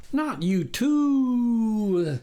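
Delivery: very slow, high, loud howl; the stranger howls at being compared to a dog; prolonged howling wail. A narrator's reading. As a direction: wail the words like a wolf. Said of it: "howled in a prolonged wail".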